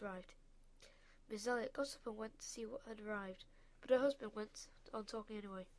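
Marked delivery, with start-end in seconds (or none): none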